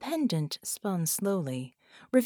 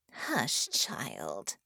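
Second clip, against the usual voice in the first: hissed; 7 semitones lower, 5 dB quieter than usual. A narrator's reading. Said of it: "Harmony hissed".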